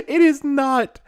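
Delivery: words squeezed out through laughter, high and loud; can barely get it out for laughing; real helpless laughter; laughing hard. Real, not acted. Cracking up over the scream.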